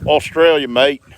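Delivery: a quick, self-mocking go at an Australian accent; bad Australian accent. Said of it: "I don't do a very good Australian accent".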